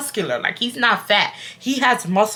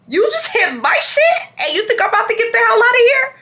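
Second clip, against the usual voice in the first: high, fast, outraged retort; furious comeback; 13 semitones higher than usual. Retold or imitated. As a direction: fire it back in disbelief. Her retold furious comeback.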